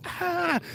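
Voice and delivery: high, soft "ah" like escaping steam; kettle shriek. Retold or imitated. Likening an angry person to a hot kettle, high imitated cry.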